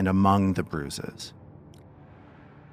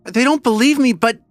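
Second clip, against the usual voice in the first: put-on high, slow, louder pleading child; frightened girl; 12 semitones higher, 4 dB louder, slower than usual. Frightened girl insists to the party, high and pleading. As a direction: insist desperately that he is real. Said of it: "she looks at you and she says".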